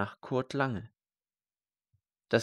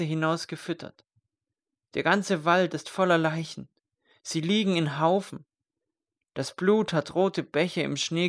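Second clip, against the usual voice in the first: higher and louder, horrified rush of memories; distraught outpouring; 6 semitones higher, 3 dB louder than usual. Acted. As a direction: pour out the horror of the slaughter. Survivor recounts the massacre.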